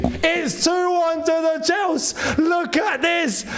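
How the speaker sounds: high, excited shouts; jubilant shouting. Real, not acted. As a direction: fan yelling in delight after the goal. Celebrating the goal.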